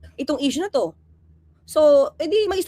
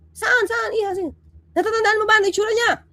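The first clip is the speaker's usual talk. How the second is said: higher-pitched acted cop asking quickly; a hint of an eager policeman probing; mock questioning officer; 6 semitones higher than usual. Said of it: "kunyari, ako yun". (bahagyang pulis na nagtatanong)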